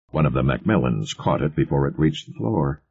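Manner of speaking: usual speaking voice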